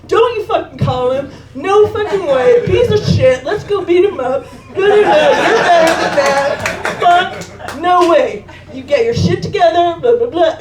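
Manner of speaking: loud, high, laughing rant; hyped-up friend yelling. Retold or imitated. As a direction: egg her on with a laughing shout. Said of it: "He's like".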